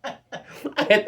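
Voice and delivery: high squealing laughter through the words; laughing hard. Real, not acted. Telling friends about cursing the driver, laughing hard. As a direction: laugh through it, can barely talk.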